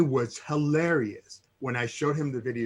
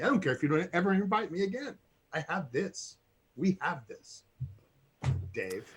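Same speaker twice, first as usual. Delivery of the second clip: mock tender; 3 semitones higher, 6 dB quieter than usual. Mock sentimental farewell to host.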